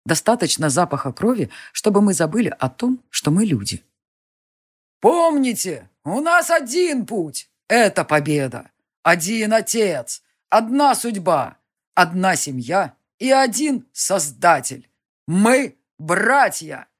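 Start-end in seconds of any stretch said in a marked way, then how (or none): 5.0-16.9 high, slow, rousing speech; rally speech. Acted. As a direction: whip up the crowd, slowly.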